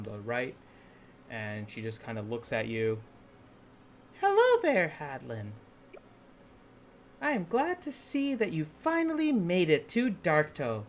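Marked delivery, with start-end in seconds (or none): 4.2-10.8 high, sly villainess voice; evil villain voice. Acted. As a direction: purr it like a scheming pirate queen. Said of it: "I don't like your evil voice".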